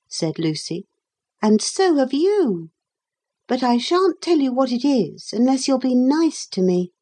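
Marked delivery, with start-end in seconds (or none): 1.4-6.9 put-on higher girl's voice, gently firm; a touch of sadness, holding firm; girl laying down her condition, mildly sad; sad but firm. A narrator's reading. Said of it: "Her tone was a little sad, but quite firm".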